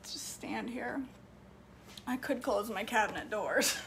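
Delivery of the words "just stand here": breathy hushed whisper, barely voiced. Real, not acted. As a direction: whisper it so nobody else hears. Whispering to viewer while filming.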